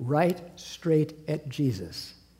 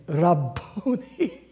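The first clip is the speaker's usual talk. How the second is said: slow, drawn-out, higher cry of wonder; joyful recognition; 4 semitones higher, 4 dB louder, much slower than usual. Acted. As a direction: let the name break open with amazed delight. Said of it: "In Aramaic".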